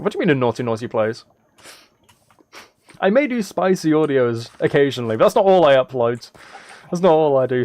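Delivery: ordinary talk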